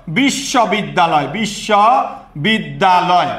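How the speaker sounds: বিশ্ববিদ্যালয় slowly broken into bish-sho-bid-da-loy; syllable by syllable. Shown as a demonstration. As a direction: draw out each syllable of বিশ্ববিদ্যালয় for counting. Teacher sounds out word's syllables. (বিশ্ববিদ্যালয় অক্ষরে অক্ষরে ভেঙে ধীরে বলা)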